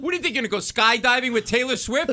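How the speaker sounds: loud, high-pitched incredulous outburst; exasperated yelling. Retold or imitated. Son arguing with his elderly mom, exasperated yelling. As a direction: yell it back in comic disbelief.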